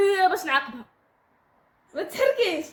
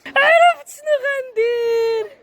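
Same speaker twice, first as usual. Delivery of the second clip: mock scheming; 6 semitones higher, 6 dB louder, much slower than usual. Announces new cruel challenge.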